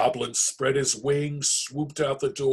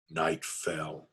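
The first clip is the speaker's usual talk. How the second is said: usual speaking voice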